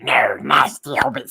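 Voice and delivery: raspy, snarling little creature voice; imitated creature rasp; Smeagol impression. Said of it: "see when he records Smeagol".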